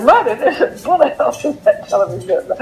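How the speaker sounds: put-on high, quick voice of an astonished child; imitated child. Katie telling her mother about the bullet hole, mimicked child's astonishment. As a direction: mimic a kid blurting it out, pitched well up.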